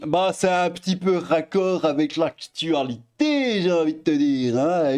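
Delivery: hint of a Belgian accent, clowning; mock Belgian accent. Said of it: "Je fais mon débile avec ma, mon accent belge". (léger accent belge pour rire)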